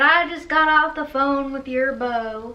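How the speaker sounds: dad impression. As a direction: imitate dad announcing it, drawn out. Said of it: "That's exactly what he said".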